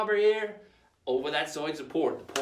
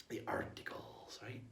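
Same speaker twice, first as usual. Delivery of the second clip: whisper; 8 semitones lower, 14 dB quieter, slower than usual. Acted. Hushed repeat of term.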